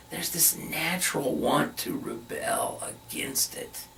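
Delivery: normal talking voice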